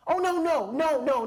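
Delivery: high, loud panicked no no no; alarmed denial. Retold or imitated. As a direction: backpedal fast, in a high panic. Alarmed at the cop.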